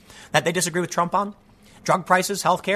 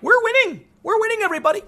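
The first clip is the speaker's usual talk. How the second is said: high-pitched mocking squeal; mock cheering. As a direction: imitate deluded cheerleaders in a silly high voice. Mocking CNN as paranoid cheerleaders.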